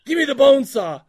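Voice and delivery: loud and high, a barked demand; movie quote yell. Pretend. Quizzing his cohost, a loud high mock demand. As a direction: shout for the saw like a frantic surgeon. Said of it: "That was Dan O'Bannon".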